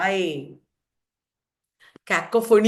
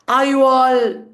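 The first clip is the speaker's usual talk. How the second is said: louder, with "all" held and left hanging; left unfinished; 2 semitones higher, 7 dB louder than usual. Shown as a demonstration. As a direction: stop on a long, loud "all".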